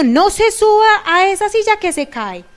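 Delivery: high, loud warning yell; scolding shout. Retold or imitated. Parent yelling at a child climbing a chair, loud and high. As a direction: snap a prohibition at the child. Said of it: "Es muy diferente".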